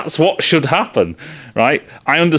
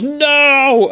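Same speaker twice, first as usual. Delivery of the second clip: shouted no; 10 semitones higher than usual. Retold or imitated. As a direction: throw out a sharp high No. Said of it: "The brother goes".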